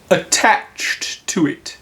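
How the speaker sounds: slow, breathless sad whisper; sadly. A narrator's reading. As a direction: Pooh sadly explaining Eeyore's attachment. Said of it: "said Winnie the Pooh sadly".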